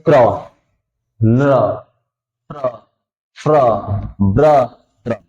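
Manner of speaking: क्र, प्र, फ्र, ब्र, त्र each said quickly as one syllable; teacher reading clusters. Shown as a demonstration. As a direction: drill the r-clusters crisply, one by one. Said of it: "अब जैसे मान लीजिए". (हर संयुक्त अक्षर तुरंत एक साथ)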